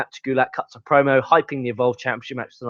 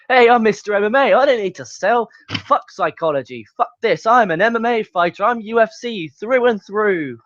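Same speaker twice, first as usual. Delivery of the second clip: mock cocky fighter; 6 semitones higher than usual. Reviewer parodying Riddle refusing to sell, mock bragging. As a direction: brag like a fighter who won't sell. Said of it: "You know, Matt Riddle".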